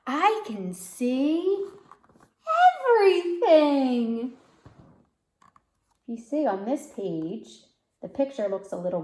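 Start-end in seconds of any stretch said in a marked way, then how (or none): 0.1-4.4 slow, high, laughing wonder; amazed child. Acted. Girl seeing clearly through glasses, delighted wonder. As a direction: marvel as the world comes clear.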